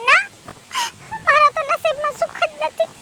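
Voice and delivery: soft voice breaking into tears; quietly weeping over her fate; crying. Acted. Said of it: "કેમ ભાભી રોવો છો તમે". (રડતાં રડતાં ધીમું બોલવું)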